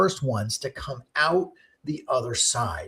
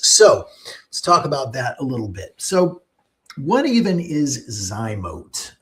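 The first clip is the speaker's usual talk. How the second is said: usual speaking voice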